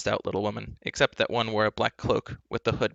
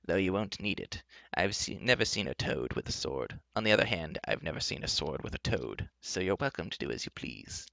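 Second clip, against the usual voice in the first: soft, low, indulgent enchantress; 3 semitones lower, 6 dB quieter than usual. Acted. Enchantress chatting to Taryn about swords, indulgent. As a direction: humour them softly as the enchantress.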